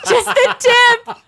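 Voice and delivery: high, slow, laughing through it; cracking up on the words. Real, not acted. Laughing repeat of joke.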